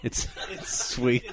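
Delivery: slow, soft, laughing "sweet". Real, not acted. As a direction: laugh it out slowly and quietly. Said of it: "Was that word just invented".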